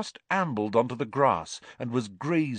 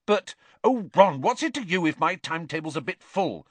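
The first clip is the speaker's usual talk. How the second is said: sharp, higher irritated snap; snapped; 9 semitones higher, 3 dB louder than usual. A narrator's reading. Hermione to Ron over her full timetable, snapping. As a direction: bite back at him. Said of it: "Hermione snapped".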